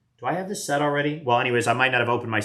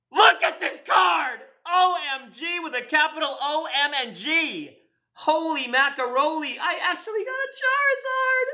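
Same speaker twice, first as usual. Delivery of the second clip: high, near-crying squeals of joy over the card; real ecstatic disbelief, voice cracking; overjoyed; 14 semitones higher than usual. Real, not acted. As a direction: lose it completely over a rare pull. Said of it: "I'm so excited".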